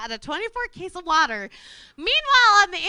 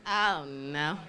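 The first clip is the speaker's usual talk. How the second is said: dread; 12 semitones lower, 10 dB quieter than usual. Retold or imitated. Realizing the price mistake.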